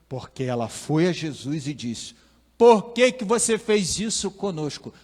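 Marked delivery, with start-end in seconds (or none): 2.6-4.9 loud, high, exasperated demand; a mother scolding her lost boy at full force; harsh scolding. Pretend. Maria scolds the lost twelve-year-old Jesus, loud and sharp. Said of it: "Talvez não nesse tom".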